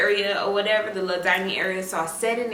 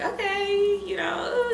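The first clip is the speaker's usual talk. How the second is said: sarcastic okay; 11 semitones higher, 3 dB quieter, slower than usual. Retold or imitated. Sarcastic acceptance, high pitch.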